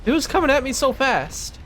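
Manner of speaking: real fright, words rushing up in pitch; jolted by a monster lunging at him; startled panic. Real, not acted.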